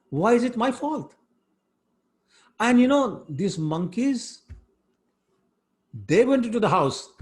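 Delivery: a naughty boy playing innocent to his mother; lightly voiced child protesting his innocence; little Krishna pleading. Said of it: "he said".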